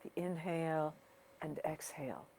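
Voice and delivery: unhurried guiding voice with long pauses, softening toward the end; guided meditation. Real, not acted. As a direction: ease listeners into their breathing, leaving space between phrases. Leads a breathing meditation.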